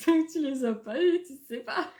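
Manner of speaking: words broken up by real laughter; laughing. Real, not acted. Laughing about planning children beforehand, amused laughing speech. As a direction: laugh while making the point.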